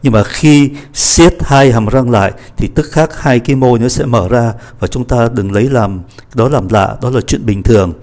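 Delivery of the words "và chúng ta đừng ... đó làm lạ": ordinary talk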